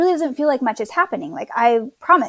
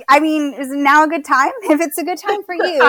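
retold teasing, higher voice breaking into laughter; laughing; 5 semitones higher, 5 dB louder than usual. Wife retelling husband's sleepy question at labor's start, laughing. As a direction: imitate a teasing question, amused and lifted. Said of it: "just laughing, 'cause he's like".

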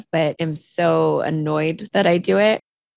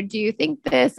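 higher-pitched, tentative question; hedging question; 6 semitones higher than usual. Retold or imitated. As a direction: ask it timidly, lifting the pitch. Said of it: "pose it as a".

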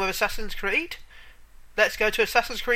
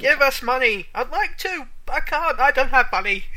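higher whiny beggar; 7 semitones higher, 5 dB louder than usual. Retold or imitated. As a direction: plead like a beggar. Voicing beggars from the game.

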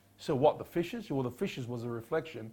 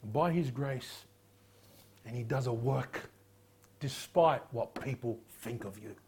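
ordinary talk

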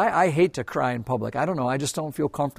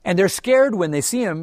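usual speaking voice

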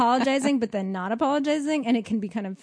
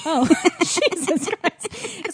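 drawn-out, higher, laughing disbelief; shocked laughter; 6 semitones higher, much slower than usual. Real, not acted. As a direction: exclaim through a burst of laughter. Reacts laughing to being-hit story.

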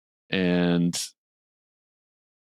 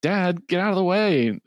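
Cindy yelling; 13 semitones higher than usual. Retold or imitated. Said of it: "And Cindy says".

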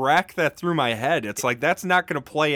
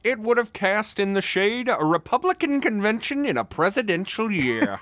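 put-on lightly performed old-time reporter, higher pitched; 8 semitones higher than usual. Co-host reading a reporter's courthouse quote, lightly theatrical. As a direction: read it out like a period newspaperman, higher to start.

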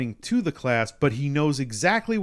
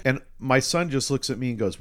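ordinary delivery

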